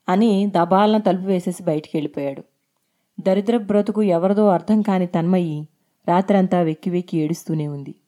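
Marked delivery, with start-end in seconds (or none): none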